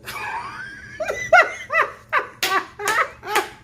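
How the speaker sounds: high-pitched peals of laughter; long real laughing fit; laughing hard. Real, not acted. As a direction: crack up helplessly. Laughs at his opening line.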